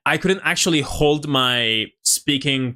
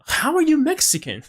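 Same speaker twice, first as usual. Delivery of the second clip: surprised; 12 semitones higher than usual. Retold or imitated. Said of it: "she was like".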